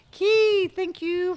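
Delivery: high, loud name call; excited greeting. Real, not acted. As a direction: shout the name in delight. Greeting a new subscriber.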